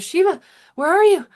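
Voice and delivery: high, loud searching call; Shakti calling out. Acted. Shakti landed in existence looking for Shiva, calling out. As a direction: call out for a missing companion, high and urgent. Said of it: "looks around and goes".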